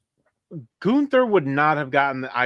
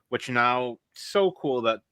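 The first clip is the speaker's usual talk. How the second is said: ordinary talk, nothing marked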